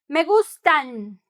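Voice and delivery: gustan slow and pressed, the final N clear; stress on gustan. Shown as a demonstration. Teacher stressing the plural ending, slow emphasis. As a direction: lean on gustan, slowly. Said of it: "Con énfasis".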